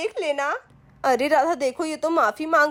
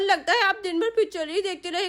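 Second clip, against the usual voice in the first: ordinary talk, nothing marked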